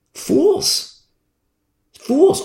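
single word pitched very high; provocative jab. Shown as a demonstration. Preacher needling those who deny the Spirit, high-pitched taunt. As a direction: toss the insult out in a high, lifted voice. Repeats the insult he was scolded for.

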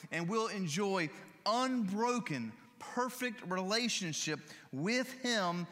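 lifted, fervent preacher voice climbing in joy; exultant preaching. Acted. Preacher telling the congregation of heaven, fervent and lifted. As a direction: proclaim heaven's promise with mounting fervor.

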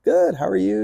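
retold high-pitched greeting back; friendly reply.

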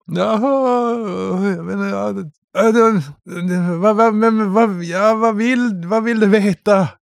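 high, panicked, stumbling over words; terrified stammer. Acted. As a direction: babble in fear after the beating. GM voices terrified beaten prisoner.

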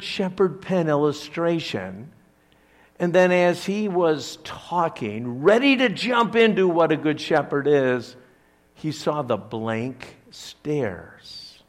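ordinary talk, nothing marked

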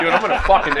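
laughing mock threat. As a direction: threaten while cracking up. Retold angry reply, laughing.

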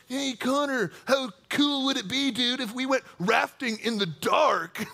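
put-on goofy teenager voice; high schooler voice. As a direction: imitate an over-eager high schooler, drawing out the end. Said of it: "that's my high schooler, uh, my high schooler voice".

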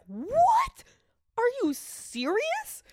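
high, loud retold disbelief; shocked disbelief. Host recalls reading the first articles, high shocked disbelief. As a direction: squeal it as if you cannot believe it. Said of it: "and I was like".

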